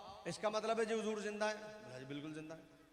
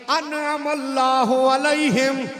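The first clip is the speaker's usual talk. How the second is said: slow, louder chanted verse; Quranic recitation; 4 semitones higher, 17 dB louder, much slower than usual. Acted. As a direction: intone the verse for the crowd. (धीमी ऊँची तिलावत)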